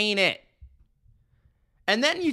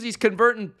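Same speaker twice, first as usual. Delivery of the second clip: ordinary talk, nothing marked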